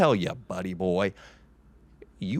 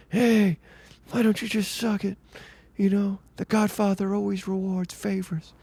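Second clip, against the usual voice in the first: high, hammed-up Godfather voice; Marlon Brando impression; 10 semitones higher than usual. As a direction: play the Godfather asking a favor. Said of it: "was the guy doing a good impression of Brando".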